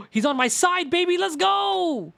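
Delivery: high, loud triumphant cheer; excited cheering. Real, not acted. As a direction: cheer like your team scored. Cheering prosecutor's support.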